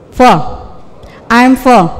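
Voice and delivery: "full" dengan u pendek mirip e; pengucapan benar. Shown as a demonstration. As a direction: ucapkan "full" pendek, condong ke e. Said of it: "Jadi gimana?". ("I'm full" dengan vokal pendek)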